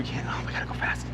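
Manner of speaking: urgent whisper; hurry, but keep it a whisper; breathless whispered rush. Real, not acted. Rushing to catch the light.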